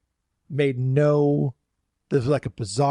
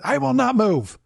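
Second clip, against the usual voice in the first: much higher, stubborn mock whine, a bit louder; defiant guest; 9 semitones higher, 3 dB louder than usual. Stubborn guest refusing parade crew, high mock whine. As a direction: whine it like a petulant child refusing. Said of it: "they're like".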